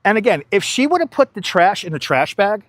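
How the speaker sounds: ordinary talk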